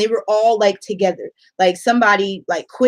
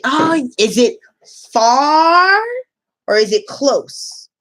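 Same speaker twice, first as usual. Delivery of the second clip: higher, slower questioning teacher voice; teacher voice to kids; 5 semitones higher, 3 dB louder, slower than usual. Shown as a demonstration. Teacher asking class if school is far, sing-song. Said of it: "If we're talking about transportation".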